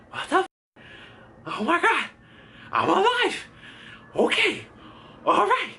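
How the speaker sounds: very high, squeaky disbelief; re-enacted shock. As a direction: replay the stunned panic in short high bursts. Re-enacting shock after crash.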